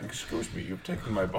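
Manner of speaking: soft, hushed mock politeness; polite whispered Dutch complaint. Dutch cyclist to English lads, hushed polite complaint. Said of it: "They're coming along like".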